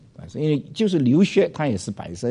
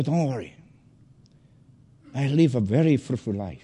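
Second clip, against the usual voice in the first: reassuring. Retold or imitated. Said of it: "what I said right away".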